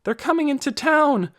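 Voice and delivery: high, rising alarmed call; alarmed shout. A narrator's reading. Villagers at their windows shouting in alarm. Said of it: "They shouted to each other".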